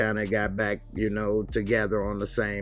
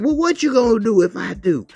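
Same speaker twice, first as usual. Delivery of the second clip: much higher and louder taunt; defiant dare; 12 semitones higher, 10 dB louder than usual. Retold or imitated. Kelly taunting the bus driver, high and loud. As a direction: dare him to stop you.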